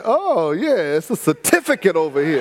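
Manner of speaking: laughing while quoting. Retold or imitated. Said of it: "I said".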